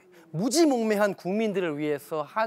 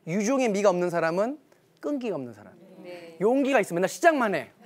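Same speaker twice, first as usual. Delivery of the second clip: normal talking voice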